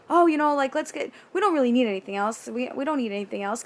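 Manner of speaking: imitated nervous hurry, starting loud; hurried excuse. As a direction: rush the shoppers along while hiding panic. Acted excuse to leave store fast.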